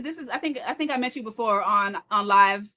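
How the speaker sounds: normal talking voice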